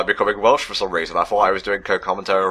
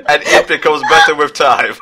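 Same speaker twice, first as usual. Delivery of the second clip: loud, grandiose, higher David Cage-style musing; 6 semitones higher, 6 dB louder than usual. Retold or imitated. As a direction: ham up a pompous poetic auteur. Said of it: "forgot how to do my David Cage voice".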